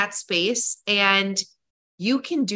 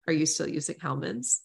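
client voice; 5 semitones lower, 9 dB quieter than usual. Retold or imitated. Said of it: "will be like".

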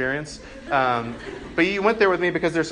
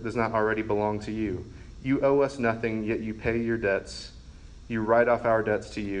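measured, lower-pitched devotional reading; prayer reading; 4 semitones lower, 4 dB quieter than usual. Acted. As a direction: read an old prayer addressed to God, phrase by phrase. Reads Augustine's prayer aloud.

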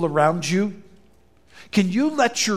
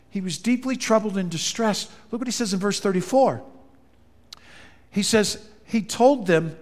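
ordinary talk

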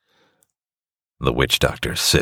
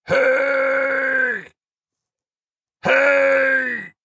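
shouting. Acted. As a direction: call out from far away. Someone yelling from down the beach.

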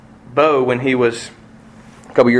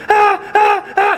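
put-on high panicked yelps of a scared kid; high broken child's cries; frightened child's cries; slower than usual. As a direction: cry out at the needle like a small boy. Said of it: "here comes the syringe".

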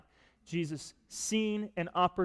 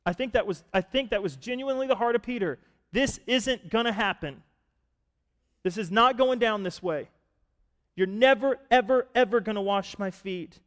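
higher and louder, stubborn insistence; determined; 7 semitones higher, 7 dB louder than usual. Retold or imitated. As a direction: dig your heels in. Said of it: "determined to stop this man".

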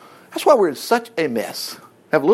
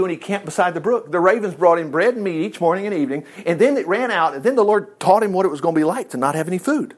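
usual speaking voice

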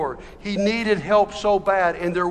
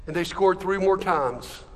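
ordinary talk, nothing marked

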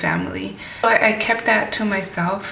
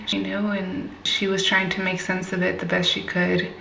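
ordinary talk

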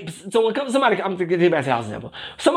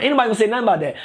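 ordinary delivery

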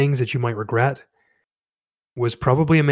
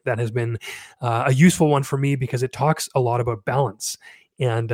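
ordinary talk, nothing marked

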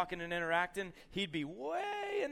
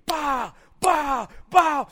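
loud explosive bahs; shouted punch noises; 10 semitones higher, 14 dB louder, much slower than usual. Retold or imitated. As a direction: bellow out each blow. Imitates fighter's punches.

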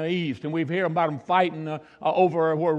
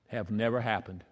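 much softer, lower, letting it sink in; softly; 9 semitones lower, 11 dB quieter than usual. Shown as a demonstration. Preacher quietly telling the church it never happened. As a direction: drop it to a hush. Repeated for emphasis.

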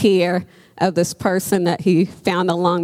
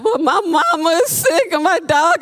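tearful patient; 8 semitones higher than usual. Retold or imitated. Patient crying to nurse.

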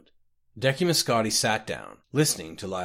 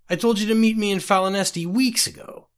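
louder, higher reproach; scolding; 10 semitones higher, 5 dB louder than usual. A narrator's reading. Eurus berates Scotty for missing the meeting, loud scolding. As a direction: tell him off hard.

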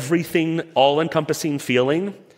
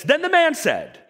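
authoritative voice; 12 semitones higher than usual. Shown as a demonstration. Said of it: "[name] authoritative voice".

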